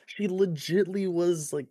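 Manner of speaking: high, loud, emphatic; excited. Real, not acted. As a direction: push it up with enthusiasm. Emphatic praise.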